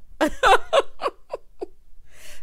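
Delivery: high wheezy laugh; breathless laugh. Real, not acted.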